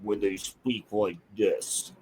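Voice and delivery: low, slow, quiet robotic monotone; low slow computer voice; Tron voice. Shown as a demonstration. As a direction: drone it flat and slow like a Tron program. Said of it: "sounded like the voice from Tron".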